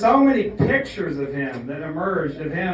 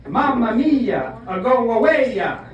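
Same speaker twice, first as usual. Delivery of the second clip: mock Italian yell; 3 semitones higher than usual. Italian woman telling the comic to go away, mock-Italian scolding. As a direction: imitate an Italian woman with a cartoonish Italian accent. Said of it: "she said it in Italian".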